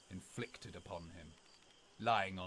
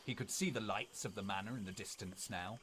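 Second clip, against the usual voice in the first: ordinary delivery, nothing marked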